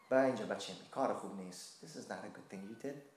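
soft, low, hushed reproach; gentle grandmotherly scolding. Retold or imitated. Grandmother hugging her grandson, soft low scolding. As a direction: chide the child tenderly, barely above a breath.

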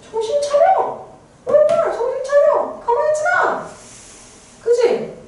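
high, urgent scolding yell; dog yelling at me. Retold or imitated. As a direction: bark at the lazy narrator to wake up. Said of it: "이러는 거지".

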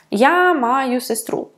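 normal talking voice

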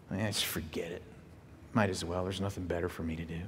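soft, hopeless whisper; defeated whisper. Acted. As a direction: let it out like someone who has given up. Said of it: "Have we ever said that".

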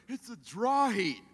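loud, drawn-out mock reassurance; mocking what people say. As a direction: mimic them loudly, stretching the words. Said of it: "this is what people go".

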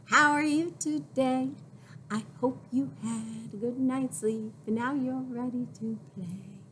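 ordinary delivery

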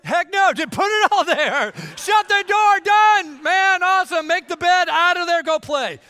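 high, loud, shouted rush through the chores; hurried kid. Retold or imitated. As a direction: blurt it out like a kid cutting corners. Kid rushing through cleaning room.

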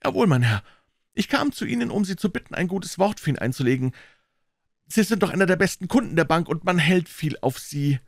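lightly voiced anxious petitioner; respectful, mildly urgent appeal; pleading brother.

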